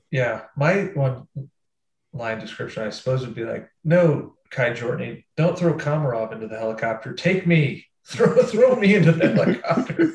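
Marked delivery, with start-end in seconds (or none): 3.8-10.1 hammed-up plea, higher and louder on the outbursts; mock heroic plea. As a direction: beg to be sacrificed, breaking into laughter. Acts out his joke plea.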